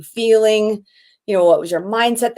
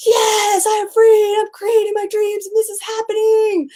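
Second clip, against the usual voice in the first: much higher, soaring shout of joy; ecstatic cry of freedom; 12 semitones higher than usual. Retold or imitated. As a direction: cry it out like you just leapt from the plane. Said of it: "being like".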